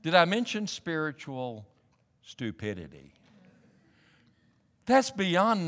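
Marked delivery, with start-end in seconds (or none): none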